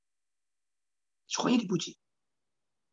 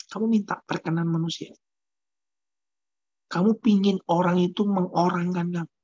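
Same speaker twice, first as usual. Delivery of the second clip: ordinary delivery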